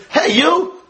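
high, offhand "hey you, come here"; mock hailing a passerby; casual call-out. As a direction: call someone over carelessly. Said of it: "Does it mean".

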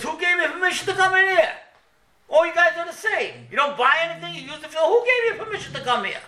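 high-pitched, furious waiter's rant; raging temper. Retold or imitated. Waiter berating customers for using the bathroom, raging temper. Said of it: "with a raging temper".

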